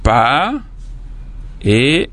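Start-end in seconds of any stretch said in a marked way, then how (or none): 0.0-0.5 "pa" alone, held and rising; separated syllable. Shown as a demonstration. Teacher splitting papa for pupils, first syllable alone. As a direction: stretch pa as a single beat. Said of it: "Vous séparez les mots papa en syllabes".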